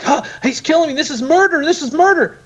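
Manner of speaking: high-pitched mock panic, murder cry; panicked victim. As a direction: cry out in pretend horror as you're poisoned. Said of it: "you'd be like".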